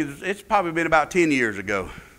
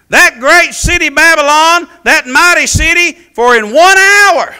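reading scripture at full preaching force, loud and raised; 9 semitones higher, 12 dB louder than usual. Acted. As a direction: proclaim the verses like a wailing prophecy.

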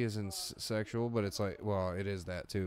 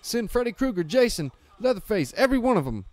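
high, loud panicked phone call; panicked Ozzy; 11 semitones higher, 9 dB louder than usual. Retold or imitated. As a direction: frantically shout for every rescue. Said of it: "what Ozzy says in Leprechaun One".

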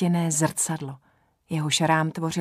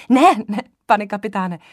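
hlasitě a naléhavě; rázně zarazit; 5 semitones higher, 8 dB louder than usual. Acted. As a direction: zastav ho dřív, než vstane. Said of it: "zarazila jsem ho včas". (žena zarazí kapitána, aby nevolal doktora, hlasitě a naléhavě)

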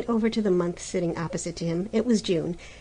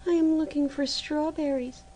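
put-on pleading girl; 8 semitones higher than usual. A narrator's reading. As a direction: plead gently, bewildered. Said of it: "Maruschka answers".

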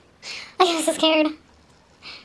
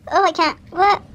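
ordinary talk, nothing marked

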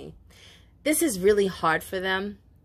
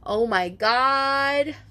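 normal talking voice

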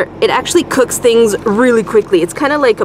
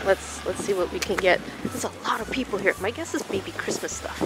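whispering in a crowded store; hushed so shoppers won't stare; library whisper; 11 dB quieter than usual. Real, not acted. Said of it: "I'm whispering 'cause people are like".

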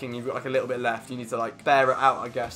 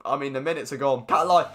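ordinary delivery

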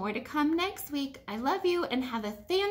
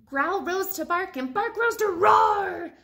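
louder and higher into a shout; rising story shout; building roar; 5 semitones higher, 7 dB louder than usual. Acted. Climax of story.

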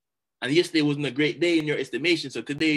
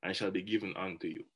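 scripture reading; 7 semitones lower, 8 dB quieter than usual. Acted. Quoting Matthew seven to the audience, softly and reverently. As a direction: read the verse reverently and quietly. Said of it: "It says simply this".

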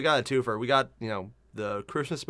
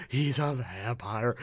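swooning fangirl; 5 semitones lower, 5 dB quieter, much slower than usual. Retold or imitated. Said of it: "It's like".

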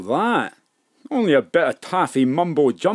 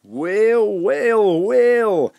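smug well well well; 7 semitones higher, much slower than usual. Acted. Gangster greets captured detective.

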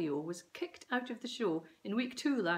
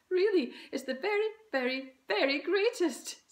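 gushing mum; 12 semitones higher, 8 dB louder than usual. A narrator's reading. Mum gushing, outbidding Dad.

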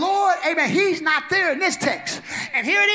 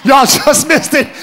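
loud slow laughing words; 6 dB louder than usual. Real, not acted. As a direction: push it out through laughter. Laughing after breaking down.

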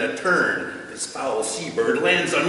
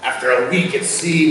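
ordinary talk, nothing marked

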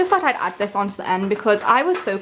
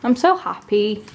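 ordinary delivery

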